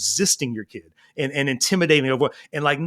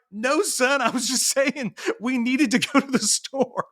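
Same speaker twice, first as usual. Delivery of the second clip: explaining dad; 9 semitones higher than usual. Retold or imitated. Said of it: "and I was like".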